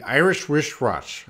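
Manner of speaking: IRISH WRISTWATCH run together in one go; quick tongue-twister attempt, words running together; fast. Shown as a demonstration. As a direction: rattle off Irish wristwatch with no gap. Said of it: "You gotta say it fast".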